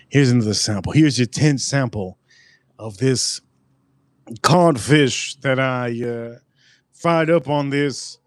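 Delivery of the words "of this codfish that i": normal talking voice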